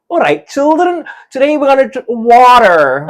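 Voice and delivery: high, loud put-on American accent; Scottish colleagues attempting American. Scottish teacher attempting American for the children, loud hammed-up American accent. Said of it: "funny for my Scottish colleagues to try".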